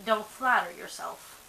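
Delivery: ordinary talk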